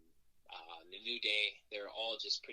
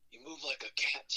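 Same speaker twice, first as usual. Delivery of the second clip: imitating a raspy, higher-pitched wrestling veteran; 4 semitones higher than usual. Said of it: "he's with his raspy voice".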